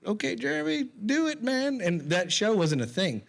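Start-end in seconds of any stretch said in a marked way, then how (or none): 0.1-1.8 high mock cheer; coworkers cheering. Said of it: "everyone's like".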